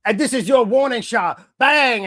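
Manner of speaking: high, loud retold warning shot; mock threat. Fan acting out a warning shot promo, mock threat.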